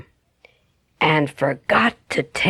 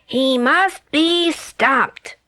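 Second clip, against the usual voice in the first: leopard voice; 7 semitones higher, much slower than usual. A narrator's reading. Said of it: "said the leopard".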